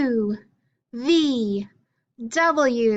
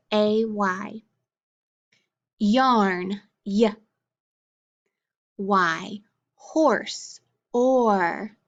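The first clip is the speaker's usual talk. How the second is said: ordinary delivery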